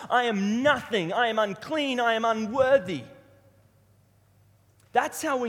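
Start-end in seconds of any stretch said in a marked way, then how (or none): 0.1-3.0 raised, emphatic self-abasement; prophet's anguished response. Acted. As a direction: proclaim each phrase high and loud. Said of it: "that was his response".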